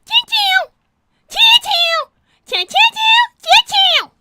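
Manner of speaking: shrill high sing-song tin-tin, loud; a high, loud, repeated imitated chime; high mock chiming. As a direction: ring it out like a cartoon bell, high and loud. Imitated noise.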